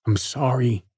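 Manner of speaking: ordinary delivery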